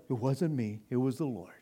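mock humility. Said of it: "that's just so phony".

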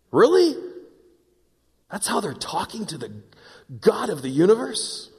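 high-pitched, disbelieving question; incredulous. Real, not acted. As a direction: leap up in pitch on "Really?", appalled. Preacher aghast at their complaint.

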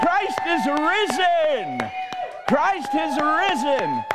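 slow, high, full shout. Real, not acted. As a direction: proclaim it loud and slow. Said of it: "You're gonna shout".

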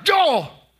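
high, loud quick yelp; loud mock answer. Mother blurting out to her daughter, loud high yelp. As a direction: snap it back loud and high.

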